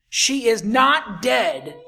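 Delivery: slow, with not punched hard; stress on not. Acted. Jesus telling the mourners she lives, stressing not. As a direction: lean on the word not.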